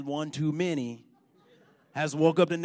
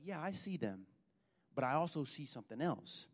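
very soft and low, unruffled; calm, hushed reply; 4 semitones lower, 15 dB quieter than usual. Acted. Elisha answering his frightened servant, calm and hushed. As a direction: answer quietly, as if letting on a secret.

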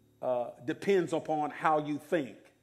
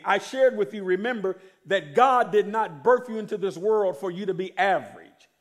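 ordinary talk, nothing marked

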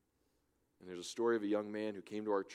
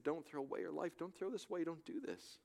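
retold plea; 8 semitones higher, 3 dB quieter than usual. Pleading with the young man.

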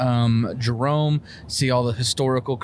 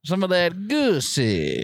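breathy vintage purr; 5 semitones higher, slower than usual. Pretend. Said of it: "I gotta talk like the time".

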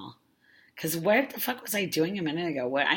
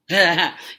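burst of laughter; 4 semitones higher, 12 dB louder than usual. Real, not acted. Breaks character after hypnosis bit.

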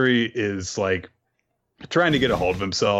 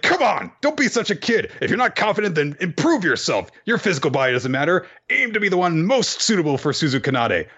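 higher, brisk scolding encouragement; high rival pep talk; 9 semitones higher than usual. Retold or imitated. To his rival Matsuri, a higher brisk scolding pep talk. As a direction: chide him to improve himself.

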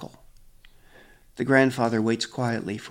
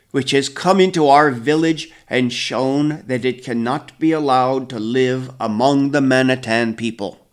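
stern verdict; 8 dB louder than usual. A narrator's reading. Grandfather passes judgment.

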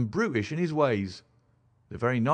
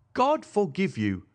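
higher, drawn-out exclamation of dismay; restrained distraught outcry; anguished cry; 5 semitones higher than usual. A narrator's reading. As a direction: cry out slowly in reproach, without shouting. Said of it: "cried Madame Charpentier".

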